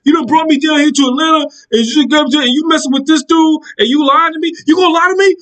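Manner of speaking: high, heated accusing voice; mock furious boyfriend confronting her; imitating the angry boyfriend. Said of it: "I was like".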